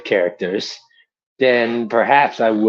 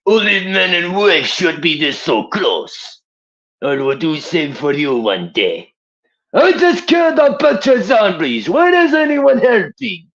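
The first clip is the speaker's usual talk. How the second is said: put-on higher Russian soldier voice, Russian accent; Nikolai; 7 semitones higher, 5 dB louder than usual. Voicing Nikolai for his viewers, in a Russian accent. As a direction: play a boastful Soviet soldier with a Russian accent. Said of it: "the next impression over here will be Nikolai".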